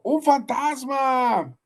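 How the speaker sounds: high, slow, terrified cry of the disciples; frightened cry. Acted. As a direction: cry out in fear as if seeing a ghost. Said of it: "dieron voces de miedo".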